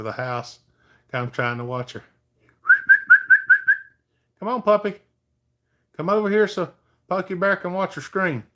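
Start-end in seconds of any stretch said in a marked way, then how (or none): 4.4-8.4 high sing-song pet talk; high, louder dog-calling voice; coaxing the dog. Real, not acted. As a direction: sweet-talk the puppy over to you.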